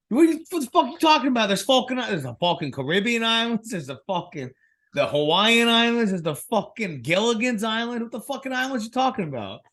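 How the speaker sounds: high-pitched, exasperated Boston rant; Bostonian accent. Retold or imitated. Classmate arguing with the professor, exasperated Bostonian accent. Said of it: "having a Bostonian classmate".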